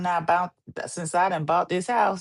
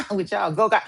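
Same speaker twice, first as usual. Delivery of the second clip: laughing through the words; giggling as she teases; 2 semitones higher, faster than usual. Real, not acted.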